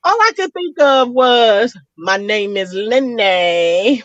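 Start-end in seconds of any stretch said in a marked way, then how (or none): 2.0-4.0 very slow, put-on character voice; mock movie quote. What she thinks of on seeing the flashing, a slow quoted voice. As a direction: drawl it out like a film line. Movie line pops into her head.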